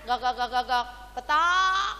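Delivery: crying-out hen. Retold or imitated. Preacher voicing hen's cry to God, clucking. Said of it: "Maka dia teriak".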